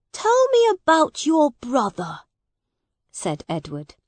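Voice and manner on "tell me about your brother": put-on high young prince, asking; a young king leaning in to hear more; curious boy king. A narrator's reading. Edward asks Miles about Hugh, high and curious.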